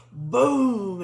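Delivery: a sudden loud crash-boom sound; a big explosive boom. Retold or imitated. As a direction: slam it out like an impact. Energy crashing to the floor.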